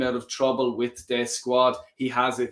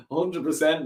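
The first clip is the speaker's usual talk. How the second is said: ordinary delivery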